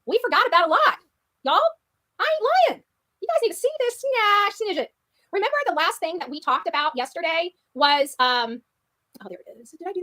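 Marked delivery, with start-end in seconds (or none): 1.4-4.9 put-on country accent. Girl doing a country voice for her viewers. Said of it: "Why is she using that country accent?".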